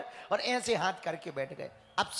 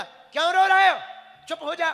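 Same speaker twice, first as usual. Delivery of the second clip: scolding shout; 8 semitones higher, 10 dB louder than usual. Retold or imitated. Grandfather scolds crying kids.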